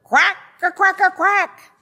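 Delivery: very high, loud quacking; duck trying to crow. A narrator's reading. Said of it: "and crowed".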